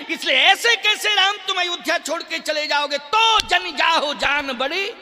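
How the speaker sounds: high, loud pleading mother; Kaushalya's impassioned plea. Acted. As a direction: press the son with rising urgency to stay. Voices Kaushalya pleading with Ram. (माँ की आवेश भरी ऊँची गुहार)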